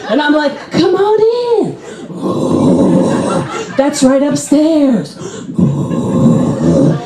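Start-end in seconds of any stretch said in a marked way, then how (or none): 0.7-1.8 high, singsong welcome; high mock-hospitable invitation; inviting call. Welcoming zombies inside. 2.1-3.8 very low undead groan; deep imitated zombie "ooh"; zombie moan. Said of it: "they'll be all like". 3.8-5.1 higher, slow usher's voice; drawn-out mock-hospitable directions; helpful directions. Directing zombies upstairs. 5.6-7.0 very low undead groan; deep imitated zombie "ooh"; zombie moan. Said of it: "they'll be all like".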